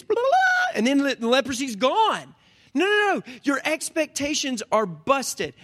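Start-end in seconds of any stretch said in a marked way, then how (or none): 0.0-0.8 shouted, very high blah blah blah; mock incantation. Preacher mocking Naaman's expected hocus pocus, high mock shout. 2.7-3.2 high, emphatic no, no, no. Real, not acted. Preacher rejecting the congregation's wrong expectations, high emphatic.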